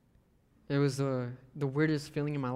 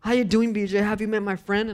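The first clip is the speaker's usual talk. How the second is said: loud, higher hyped-up bro greeting; Bryce's loud greeting; 5 semitones higher, 6 dB louder than usual. Retold or imitated. Pastor imitating his camp friend, loud hyped-up bro voice. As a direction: call out big like a pumped-up camp kid. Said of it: "Bryce Rayburn at camp used to go".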